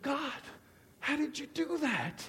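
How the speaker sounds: high, soft wonder; hushed awe. Retold or imitated. Man asking God how he was freed, hushed awe. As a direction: ask in quiet amazement. Retold amazement at being freed.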